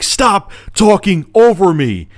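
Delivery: high, slow, exasperated mock yell; retold angry scream. Screaming customer cuts off phone rep talking over him, retold yell. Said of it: "While I'm screaming".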